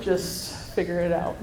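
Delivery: laughing through the words. Retold or imitated. Mom laughing as she retells her order to her girls. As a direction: say it with a laugh breaking in. Telling daughters to get along.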